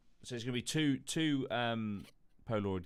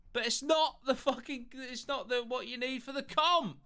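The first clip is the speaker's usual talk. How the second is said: exasperated; 14 semitones higher, 3 dB louder than usual. Real, not acted. Exasperated at wrong comments.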